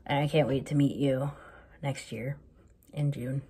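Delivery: ordinary talk, nothing marked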